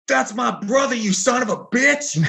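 high, furious yell from across the bar; enraged shout, voice jumped way up; retold angry yell. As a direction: bellow it at the guy choking your brother. Brother yells at the narrator.

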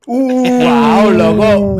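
high, loud delighted exclamations; real loud amazement; excited wow. Real, not acted. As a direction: whoop at the great comeback. Reacts to a perfect comeback.

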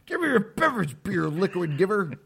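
high demanding voice; silly saloon customer. Acted. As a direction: order a beer like a goofy cowboy. High put-on voice, laughing.

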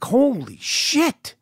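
slow, high, drawn-out amazement; amazed reaction. Retold or imitated. Guest reacting to the love stories, amazed.

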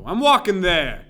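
put-on loud, slow New York accent shout; slow, loud Brooklyn accent yell. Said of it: "the only one I do regularly".

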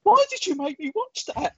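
high, pleading wail; high upset complaint to a friend; distressed. Retold or imitated. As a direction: beg her to explain, half-traumatised. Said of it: "And I said".

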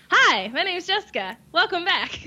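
high, chirpy vlog intro; mock a bland YouTube greeting; cheesy YouTuber intro voice. Mocking generic YouTube intro.

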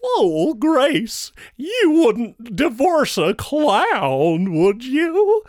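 high, slow, sing-song clown. Retold or imitated. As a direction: coax like a pleading clown. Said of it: "If I had been able to say to her".

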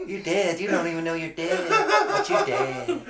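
laughing imitation in a soft Southern accent. Mocking the hospital preacher in a soft Southern accent. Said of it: "that fucking southern accent makes it so great".

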